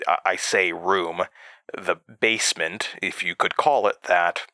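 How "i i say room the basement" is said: ordinary delivery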